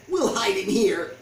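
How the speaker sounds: loud urgent goblin plan. A narrator's reading. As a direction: push them into hiding. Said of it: "said Goblin".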